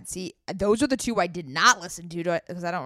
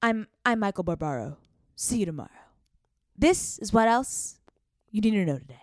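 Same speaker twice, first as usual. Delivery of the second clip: a hint of a hushed news podcast host; Michael Barbaro impression. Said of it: "that's the Daily".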